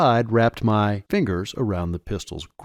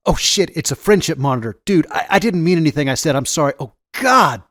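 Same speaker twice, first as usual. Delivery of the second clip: higher, louder, hurried frightened apology; panicked babbling; 6 semitones higher, 4 dB louder, faster than usual. A narrator's reading. Teen caught cursing before a friendship monitor, babbling in panic. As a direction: babble in panic, pitch rising. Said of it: "babbled the teen".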